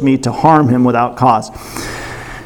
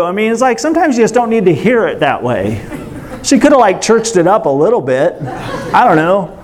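ordinary talk, nothing marked

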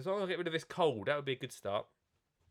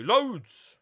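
shouted read; 7 semitones higher, 8 dB louder than usual. Retold or imitated. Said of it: "he doesn't shout that bit".

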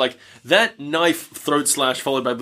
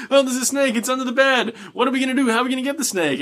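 mock panic; 10 semitones higher, faster than usual. Teens panicking about the snake.

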